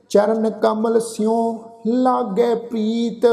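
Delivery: slow, drawn-out chanted reading of the verse; measured Gurbani path recitation; slow scripture recitation. Shown as a demonstration. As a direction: recite each line slowly and reverently. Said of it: "ਠੀਕ ਹੈ ਨਾ ਜੀ".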